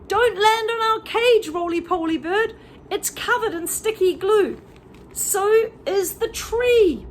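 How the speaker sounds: high, loud squeaky monkey voices calling out; high monkey chorus, loud and urgent; monkeys shouting warning. Acted. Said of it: "they shouted out".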